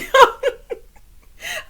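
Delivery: high, loud peals of laughter; loud squealing laugh; real laughing fit. Real, not acted. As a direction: crack up helplessly. Amused by Danny's line.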